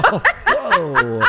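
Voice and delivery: laughing, astonished whoa; amazed, with a laugh. Real, not acted. Reacting to record-scoring game.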